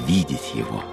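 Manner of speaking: normal talking voice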